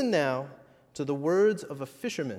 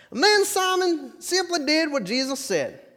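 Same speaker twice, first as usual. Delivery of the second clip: high, loud frontier twang, full of wonder; excited old Wild West fisherman; 8 semitones higher, 8 dB louder than usual. Acted. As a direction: tell the miracle like an astonished old prospector. Fisherman character, excited retelling.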